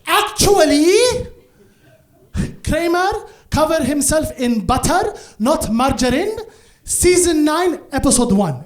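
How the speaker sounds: high, loud know-it-all mom; pedantic correction. Acted. Mom corrects the judge on Seinfeld trivia, loudly pedantic. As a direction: pounce on the error, emphatic and precise.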